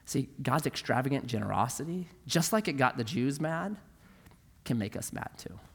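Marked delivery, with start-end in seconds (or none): none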